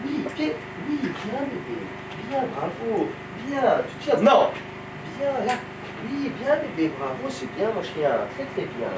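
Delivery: soft, cooing praise for a dog; talking to a dog. Real, not acted. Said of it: "trainer praises dog in demo".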